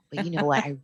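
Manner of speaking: usual speaking voice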